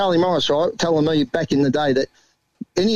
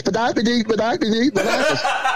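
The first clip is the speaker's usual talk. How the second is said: higher nonsense babble, imitating a mate; mock a mate's silly jabber; teasing a mate, higher nonsense babble; mocking Jake's babble; 5 semitones higher than usual. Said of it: "he just sits and goes".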